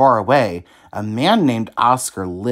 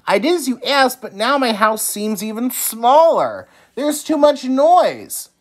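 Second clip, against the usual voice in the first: distressed Oscar; 11 semitones higher, 3 dB louder than usual. A narrator's reading. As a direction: cry out in frustration. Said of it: "he cried".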